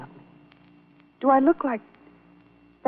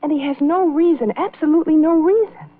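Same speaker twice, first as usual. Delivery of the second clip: higher, louder, rising insistence; distressed protest; 9 semitones higher, 6 dB louder than usual. Acted. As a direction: protest with mounting desperation. Wife protests husband's groundless jealousy.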